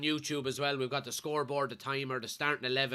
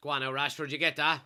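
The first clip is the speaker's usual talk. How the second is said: usual speaking voice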